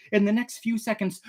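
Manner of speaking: ordinary delivery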